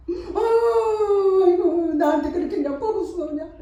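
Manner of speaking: high, drawn-out aigo wail then a rush of complaint; a hurt mother's keening cry; wailing lament. Acted. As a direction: wail like a grieving mother, then pour it out fast. Mother wails about abusive child.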